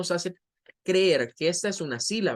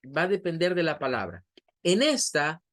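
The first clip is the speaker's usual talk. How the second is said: normal talking voice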